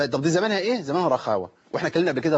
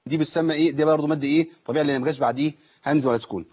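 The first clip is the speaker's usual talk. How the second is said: ordinary talk, nothing marked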